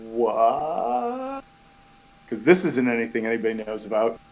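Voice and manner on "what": drawn-out what, rising high; confused what. Acted. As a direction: let it climb into a puzzled question. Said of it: "head twisting to the side, like".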